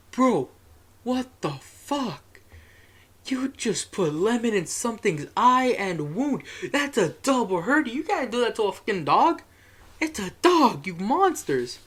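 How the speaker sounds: high, slow, stretched-out indignant outburst; appalled retold rant, swooping high on key words; outraged scolding. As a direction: tear into them in drawn-out, high-pitched disbelief. Retelling outburst at dog's abusers.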